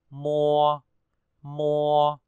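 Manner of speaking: mua said as m plus a rounded ua, not mô; correct mua. Shown as a demonstration. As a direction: stress that it's mua, not mô. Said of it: "Nhớ là đọc là mua, chứ không phải là mô". (đọc đúng mua, không phải mô)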